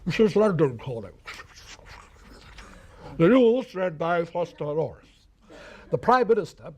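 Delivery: imitating a BBC newsreader on shortwave. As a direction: read it like old shortwave London news. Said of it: "a voice would go".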